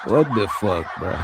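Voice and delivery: usual speaking voice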